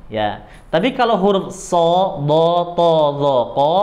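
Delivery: usual speaking voice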